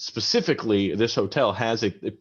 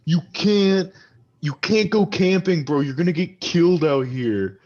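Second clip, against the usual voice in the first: put-on high, slurring drunk arguing with his buddy; drunk quarrel; 9 semitones higher, 5 dB louder than usual. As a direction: snap at your drunk friend to calm down. Drunk friend argues with Jacob.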